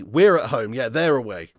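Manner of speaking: usual speaking voice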